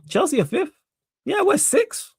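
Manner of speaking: very high, rising disbelief; incredulous, pitch soaring on each phrase; astonished. Real, not acted. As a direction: squeak it out, stunned by the table. Checks table, finds team sixth.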